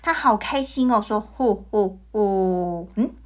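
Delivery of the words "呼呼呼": low slow jolly ho-ho-ho; Santa Claus laugh. A narrator's reading. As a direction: chuckle deep and slow like Santa. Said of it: "这好像圣诞老人的笑声哦". (像圣诞老人一样低沉缓慢地笑)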